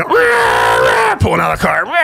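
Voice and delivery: high, loud rah shouts; triumphant yell. Retold or imitated. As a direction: whoop like a gang driving off. Skaters cheering as they leave.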